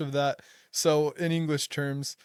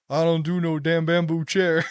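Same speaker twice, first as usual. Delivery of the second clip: louder, hammed-up big guy refusing a flimsy chair; imitating Paulu; 3 semitones higher, 7 dB louder than usual. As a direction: belt it out as the friend turning down the seat. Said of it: "He's like".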